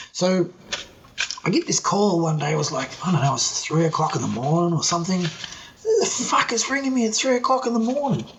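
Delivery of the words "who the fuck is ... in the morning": higher-pitched, irritated retelling; annoyed disbelief. Retold or imitated. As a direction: grumble in mock outrage at a night-time call. Woken by night phone call.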